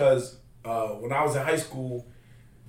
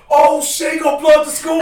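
high, loud imitated kid's yell; 14 semitones higher, 11 dB louder than usual. Mean high school kid heckling principal, imitated yell. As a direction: holler it like a kid across the school. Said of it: "one kid yelled out".

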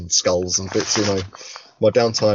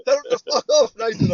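inner devil voice; 12 semitones higher than usual. Retold or imitated. Shoulder devil urging him to tell the bloke off, high mock yell. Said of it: "all I could hear in the back of my brain".

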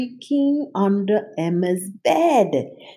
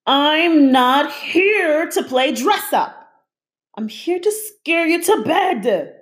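loudly rattled; 11 semitones higher, 4 dB louder than usual. A narrator's reading. Cynthia scolding the little girl, loud and indignant. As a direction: bristle with offended menace. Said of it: "Cynthia rattled loudly".

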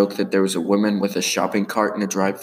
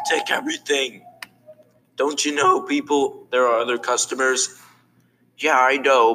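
higher-pitched character voice; arguing cartoon characters; 5 semitones higher than usual. Characters quarrelling over other customers, arguing. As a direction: argue it in a raised cartoon voice.

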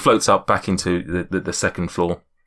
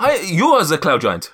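put-on higher accented giant, amused; muddled insistence in a put-on accent; Zephyros; 5 semitones higher, 4 dB louder than usual. Giant confusing himself with his guest, high accented voice. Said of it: "my accent's just all over the place".

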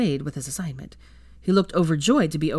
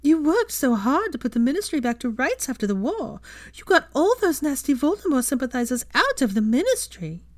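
higher, louder, gushing mock falsetto; mocking Hermione impression; 9 semitones higher, 3 dB louder than usual. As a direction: lay the flattery on thick, hammed-up. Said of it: "Harry's impersonation of Hermione".

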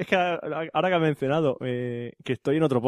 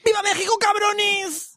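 very high, drawn-out mock yell; patriotic cry; much slower than usual. Co-host saluting the Mexican email, high mock cry. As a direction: squeal it like a fiesta cheer.

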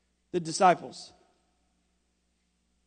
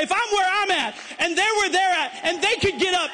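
loud, high, shouted sermon climax; preaching at full force; 7 semitones higher, 6 dB louder than usual. Acted. Pastor shouting hope to a congregation, at full force. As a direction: preach it hard, building to a shout.

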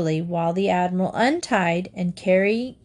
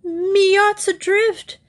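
high, slow alarmed cry; 12 semitones higher, 3 dB louder, slower than usual. A narrator's reading. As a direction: wail it in alarm. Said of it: "he cried".

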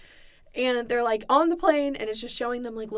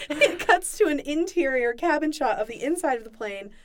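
ordinary talk, nothing marked

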